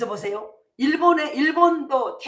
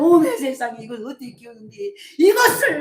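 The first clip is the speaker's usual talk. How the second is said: dismayed wail; 3 semitones higher, 10 dB louder than usual. Retold or imitated. Villagers wailing over their fallen apples, loud and dismayed.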